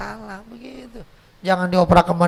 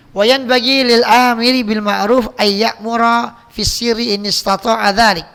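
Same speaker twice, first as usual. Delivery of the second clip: louder, chanted Arabic citation; Arabic recitation; 6 dB louder than usual. Acted.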